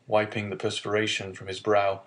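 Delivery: usual speaking voice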